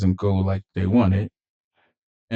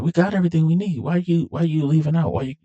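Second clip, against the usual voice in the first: high-pitched imitation of an exasperated woman; acted fed-up plea; 8 semitones higher, faster than usual. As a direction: plead with him, voice pitched up. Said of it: "'cause she's tired of it".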